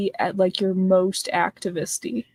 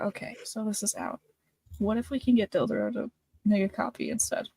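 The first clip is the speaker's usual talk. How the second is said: whispering; 8 dB quieter than usual. Acted. Character whispers plan.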